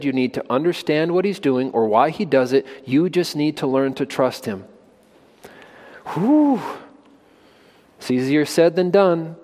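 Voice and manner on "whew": high whistled whew of awe. Real, not acted. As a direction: let out a long, high whew. Reacting to hard truth.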